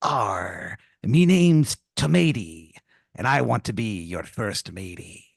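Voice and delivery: slow, growly pirate drawl; hammed-up pirate tomato. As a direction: introduce yourself like a jolly pirate. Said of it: "and he goes".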